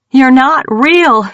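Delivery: loud, high, slow shout. A narrator's reading. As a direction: yell it in desperation. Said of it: "Mackenzie shouted".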